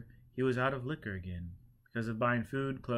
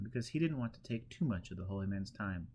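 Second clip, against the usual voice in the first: plain reading voice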